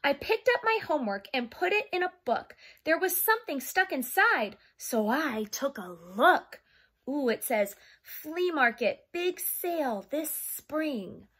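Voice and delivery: lively picture-book read-aloud; reading to children. Acted. Teacher reading a storybook to her young class, lively. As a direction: read it like storytime for little kids.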